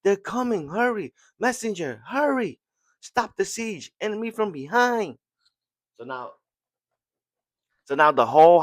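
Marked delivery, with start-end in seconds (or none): 0.0-5.2 high, frantic battlefield cries; urgent alarm. Retold or imitated. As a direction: shout the warning in a panic, pitched way up. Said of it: "she's like".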